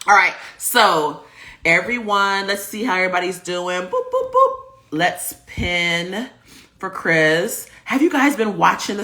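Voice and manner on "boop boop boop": high playful boops; boop sounds. Acted. Host pinning a comment, high playful boops. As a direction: pop out little boops.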